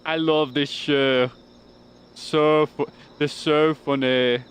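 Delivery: put-on loud, drawn-out Scottish accent; imitated Scottish accent. Quoting a clip about Scottish people, hammed-up Scottish accent. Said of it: "talking about Scottish people".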